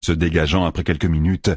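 usual speaking voice

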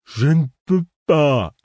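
plainte aiguë et lente d'enfant; gémir comme un gamin buté; whiny tattling little sibling. Acted. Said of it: "s'exclama-t-il d'un ton génial". (voix geignarde d'enfant aigu et lent)

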